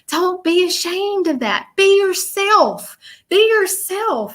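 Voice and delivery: slow, gentle coaxing like a kind spirit; spirit's reassurance. Retold or imitated. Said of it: "She's showing me, like".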